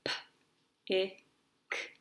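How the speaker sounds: each sound of "P, I, K" said separately with a pause between. Shown as a demonstration. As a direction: say "P, I, K" as three isolated phonics sounds, not letter names. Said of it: "they would do that with me".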